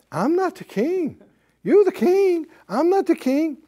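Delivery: high, lightly mocked secretary voice; protesting underling. Secretary Susie refusing the king's order, protesting.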